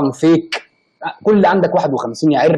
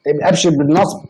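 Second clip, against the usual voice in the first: ordinary delivery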